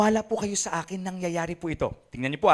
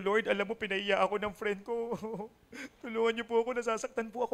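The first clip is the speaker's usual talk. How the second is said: soft, lightly acted tattling to Daddy God; nagsusumbong; 3 semitones higher, 6 dB quieter than usual. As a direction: complain softly like a child to a father. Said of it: "Na nagsusumbong po sila". (bahagyang pag-arte ng batang nagsusumbong)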